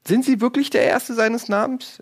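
high, incredulous question; doubtful. Acted. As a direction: sound openly sceptical. Interviewer doubts the king.

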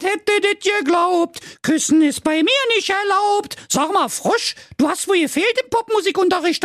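extremely high, indignant princess; high, emphatic outraged rant; Carola Knackwurst. Acted. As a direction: forbid it in a piercingly high diva voice. Voices Carola refusing the kiss.

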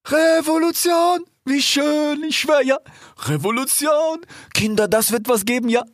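high, excited cheering; cheerful cry. Acted. Ilias hopping about, cheering for the revolution. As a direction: bounce with joy as you shout it. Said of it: "sprang fröhlich umher und er rief".

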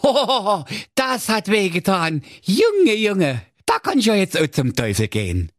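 put-on high cheeky young lad; Schnitzelschorsch voice. A narrator's reading. Narrator voicing Schnitzelschorsch, a cheeky high voice. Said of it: "da sprach er".

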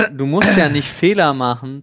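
normal talking voice

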